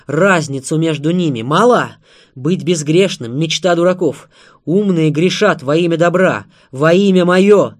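loud, forceful preaching, building to a cry; fervent declaiming, climbing to a shout; impassioned tirade. A narrator's reading. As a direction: drive each point home with full voice. Maria's heated tirade at Anna.

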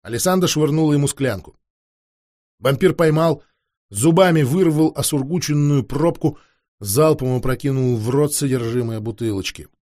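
plain reading voice

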